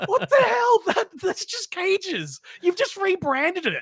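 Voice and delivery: can't believe what he's hearing; exasperated disbelief. Real, not acted. Host reacting to the new name, in loud high disbelief. Outraged at the rebranding.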